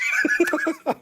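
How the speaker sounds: high squealing laugh. Real, not acted.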